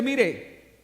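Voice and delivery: ordinary delivery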